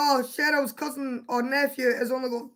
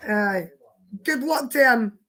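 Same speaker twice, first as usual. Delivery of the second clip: normal talking voice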